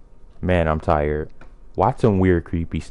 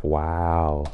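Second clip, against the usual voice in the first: normal talking voice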